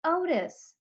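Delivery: calling out high over the rain; hollered. A narrator's reading. Girl calling to Otis over the rain, hollering. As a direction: holler his name across the yard. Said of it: "I hollered at him over the rain".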